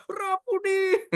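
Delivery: high, long-drawn happy call; overjoyed cry. Acted. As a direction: cry out slowly in acted joy. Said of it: "Saking senengnya". (seruan girang, tinggi dan panjang)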